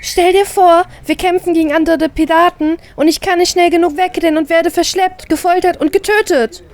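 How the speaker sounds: high, loud, worked-up dramatic pitch; excited. Acted. Sister dramatizes pirate dangers to convince her brother, excitedly. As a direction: spin the horror story breathlessly. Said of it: "meine ich aufgeregt".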